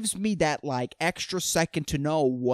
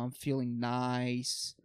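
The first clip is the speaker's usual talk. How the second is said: mocking mellow people; 5 semitones lower, 10 dB quieter, much slower than usual. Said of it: "people are like".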